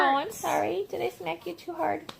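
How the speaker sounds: high, soft cooing concern; tender apology. Real, not acted. Mom checking on child she smacked, tender apology.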